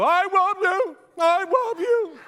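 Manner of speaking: high, loud dog-howl voice; talking dog imitation. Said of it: "the dog go".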